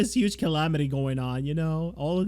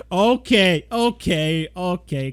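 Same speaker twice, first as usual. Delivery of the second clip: loud laughing okay; 4 semitones higher, 6 dB louder, slower than usual. Acted. Backing off amid laughter.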